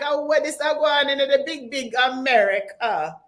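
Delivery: mock Jamaican accent. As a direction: tease like a Jamaican cousin watching America. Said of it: "some of my cousins are saying in Jamaica".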